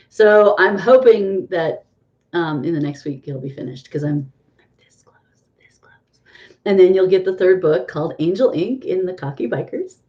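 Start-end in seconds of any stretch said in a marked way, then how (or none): 4.6-6.2 very soft whispered aside. Real, not acted. Promising viewers the book will be finished soon, whispered. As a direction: whisper it under your breath. Repeats how nearly finished she is.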